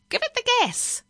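very high, loud squawk; parrot cry. A narrator's reading. Speed-hungry parrot urging on the driver, crying out. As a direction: shriek the order like an excited bird. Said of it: "the parrot cried".

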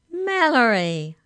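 high, loud, drawn-out joyful call; happy cry. A narrator's reading. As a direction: call the name out in delight. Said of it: "cried Arthur happily".

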